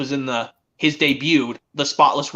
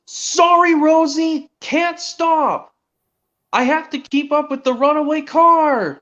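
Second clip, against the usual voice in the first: high, urgent cartoon-engine call; Thomas; 10 semitones higher, slower than usual. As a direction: shout an apology while racing past. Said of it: "when Thomas says".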